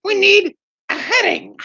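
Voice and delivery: high, drawn-out silly skit voice; hammed-up absurd demand in a shrieky falsetto; Monty Python. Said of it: "Too much Monty Python".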